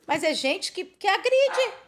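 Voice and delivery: high, loud outraged cry; rising up in outrage at aggressors. Real, not acted. Preacher crying out against people who attack others, outraged.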